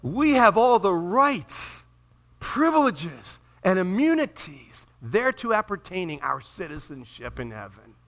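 slow, ringing declaration; grand proclamation. Real, not acted. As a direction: proclaim it grandly, drawing out each item. Proclaims diploma wording as believers' own.

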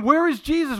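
high, urgent questioning; anxious demand. Acted. Sisters wait beside their sick brother, anxious demand. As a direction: ask it urgently, pitched well up.

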